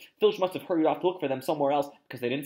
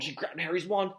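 ordinary delivery

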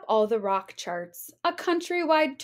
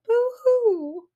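high, louder hammed-up boo-hoo; mock sniffle; 11 semitones higher, 4 dB louder than usual. Baby Bear over his broken stand, hammed-up sniffle. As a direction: pretend to blubber like a little cub. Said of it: "He started to sniffle".

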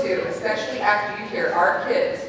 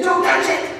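warning shout; 8 semitones higher, 4 dB louder than usual. Retold or imitated. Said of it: "and I said".

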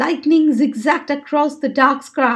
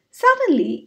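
plain reading voice

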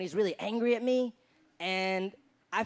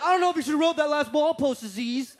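higher-pitched mock-angry scolding; furious Harris; 4 semitones higher than usual. Harris telling off his cousin over a post, mildly hammed-up anger. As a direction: act out tense, irked disapproval. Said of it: "Harris got fucking furious".

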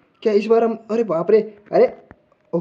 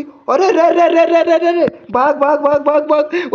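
panicked yelling; 7 semitones higher, slower than usual. Real, not acted. Gamer chased by the monster, yelling at himself to run. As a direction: scream to yourself to run. Monster chasing, running away.

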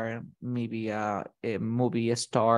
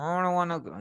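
put-on higher-pitched sulky child through pouted lips; pouting kid; 4 semitones higher, faster than usual. Shown as a demonstration. Teacher imitating a kid refusing to go, sulky pout. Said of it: "maybe kids, you know, maybe the kids".

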